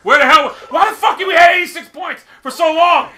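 high, loud, incredulous outburst; exasperated shouting. Real, not acted. Gamer stuck at eighty-six points, exasperated shout. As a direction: yell in real disbelief. Player losing his lead.

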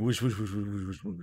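rapid run of whooshes; imitated whooshing. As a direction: mimic stars streaking past in a blur. Imitates coloured stars streaking past.

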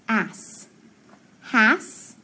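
ass then has, slow; sounding out. Shown as a demonstration.

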